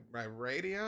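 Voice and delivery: normal talking voice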